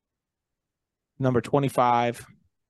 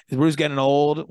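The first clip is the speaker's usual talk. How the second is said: higher, louder rhetorical question; 5 semitones higher, 6 dB louder than usual. Real, not acted. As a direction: punch the question out.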